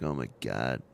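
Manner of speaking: very low, hushed dismay; quiet replayed groan of embarrassment; retold mortified aside. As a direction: drop pitch and volume, embarrassed. Retold mortified reaction to child.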